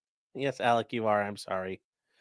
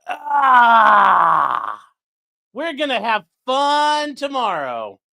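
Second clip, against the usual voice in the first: gleeful lawyer; 6 semitones higher, 6 dB louder, much slower than usual. Retold or imitated. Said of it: "Alessi's like".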